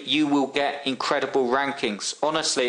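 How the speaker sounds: ordinary delivery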